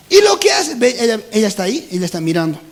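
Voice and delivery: normal talking voice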